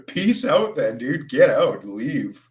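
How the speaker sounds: high laughing brush-off; dismissive. Real, not acted. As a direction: shoo the troll away, laughing. Telling troll to leave, laughing.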